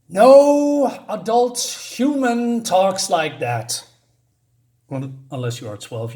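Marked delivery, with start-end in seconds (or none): 0.1-3.9 high, loud, slow scorn; exasperated. Real, not acted. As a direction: drag it out in disbelief. Exasperated verdict on dialogue.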